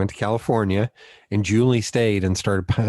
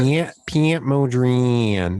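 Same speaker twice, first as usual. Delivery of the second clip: slow, stretched-out Southern drawl; imitated Southern accent; 3 semitones higher, much slower than usual. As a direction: imitate a teacher's long Southern vowels. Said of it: "That's how she talked".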